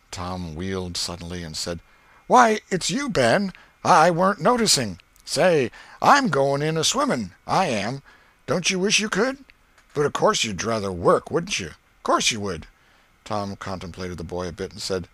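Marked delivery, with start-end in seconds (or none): 2.3-12.7 put-on high, lively kid's voice; cheeky boy. Ben bragging to Tom, high lively boy. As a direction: brag about swimming.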